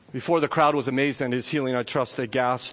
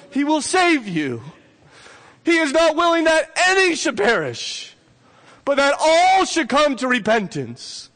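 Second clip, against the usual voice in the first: full-force preaching; 10 semitones higher, 6 dB louder than usual. Acted. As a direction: preach it out to the whole room. Preacher's appeal continues.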